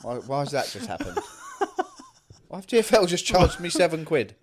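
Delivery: disbelieving words through laughter; laughing. Real, not acted. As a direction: laugh while asking in disbelief. Laughing at surprise charge.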